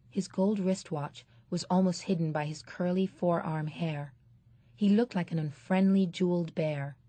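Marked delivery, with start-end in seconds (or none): none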